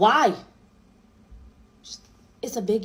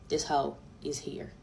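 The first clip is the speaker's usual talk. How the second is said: whisper; 7 semitones lower, 10 dB quieter than usual. Retold or imitated. Whispering about the ex at yoga.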